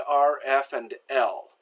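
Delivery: usual speaking voice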